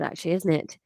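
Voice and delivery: ordinary delivery, nothing marked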